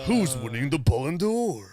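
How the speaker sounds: slow villain voice; drawn-out cartoon villain line; evil character voice. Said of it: "you sound like A J Buffon".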